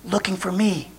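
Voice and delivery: tiny, hushed punchline; whispering child. Acted. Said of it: "Jimmy whispered".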